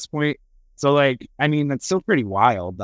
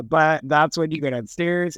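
ordinary talk, nothing marked

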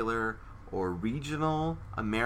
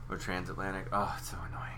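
whisper; 3 semitones lower, 8 dB quieter, faster than usual. Real, not acted. Whispered aside about the accent.

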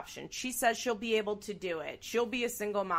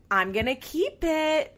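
higher, louder and slow, eerie grin; eerie smiling teen; 8 semitones higher, 7 dB louder than usual. Retold or imitated. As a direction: say it with a creepy Cheshire smile. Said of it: "weird, like, Cheshire smile on her face".

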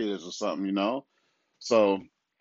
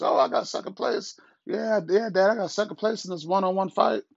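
put-on high, whiny kid voice boasting about a loss; mock whiny kid; 11 semitones higher than usual. Father mocking a son reporting a lost fight, high whine. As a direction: squeak it out like a kid bragging. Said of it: "tell my parents".

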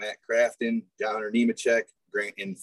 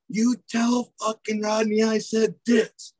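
higher, slower mock rant; angry Kevin on radio; 11 semitones higher, slower than usual. Kevin yelling at his spotter over radio, mock angry. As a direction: ham up a driver losing it.

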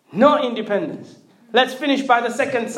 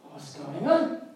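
low, soft, casual question; understated, low-key polite question; polite inquiry; 5 semitones lower, 7 dB quieter, slower than usual. Acted. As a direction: ask it quietly, just to be polite. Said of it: "just to be polite".

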